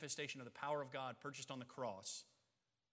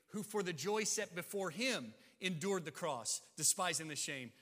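loud, high pulpit delivery; earnest preaching at full force; impassioned preaching; 4 semitones higher, 7 dB louder than usual. Acted. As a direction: drive the point home to the church. Preaching on Jesus' endurance.

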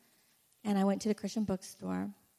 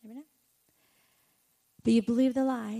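ordinary talk, nothing marked

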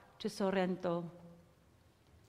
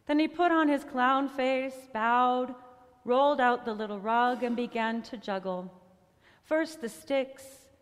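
plain reading voice

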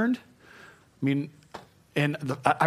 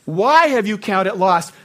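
God's rebuke; 6 semitones higher, 8 dB louder than usual. Retold or imitated. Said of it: "the Lord said these words to me".

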